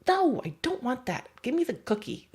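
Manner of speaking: high, put-on dog voice; a dog asking for the cookie instead; dog wanting the treat. Dog to the trainer, high put-on plea. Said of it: "He's like".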